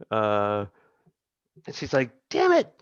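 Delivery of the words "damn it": a higher, sharp damn it; mock muttered curse. Swordswoman cursing after barely cutting a forearm, frustrated. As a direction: curse it under your breath like a frustrated fighter. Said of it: "you hear her mutter".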